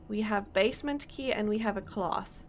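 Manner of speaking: ordinary talk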